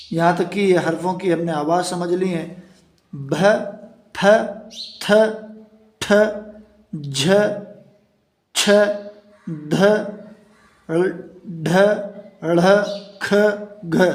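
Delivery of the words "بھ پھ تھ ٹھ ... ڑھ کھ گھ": بھ through گھ each given a breathy puff, paced out one by one; aspirated letter drill. Shown as a demonstration. Teacher drilling the aspirated sounds for students. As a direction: recite بھ, پھ, تھ with clear aspiration. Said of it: "یہ آواز یہاں پر نکل گئی ہے".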